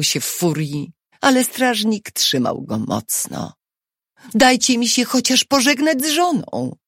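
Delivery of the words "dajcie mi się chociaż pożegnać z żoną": high, urgent plea; desperate request in a raised voice; pleading. A narrator's reading. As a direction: beg the captors, pitched well up. Prisoner pleads for a farewell.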